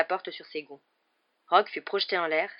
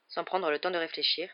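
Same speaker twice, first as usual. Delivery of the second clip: plain reading voice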